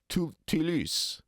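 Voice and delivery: Toulouse with a French U vowel in both syllables; slow, affected Toulouse with French U; mispronounced French. Retold or imitated. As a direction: overdo the French on Toulouse, rounded "ee" for each oo. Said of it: "I'm gonna use the French vowel U".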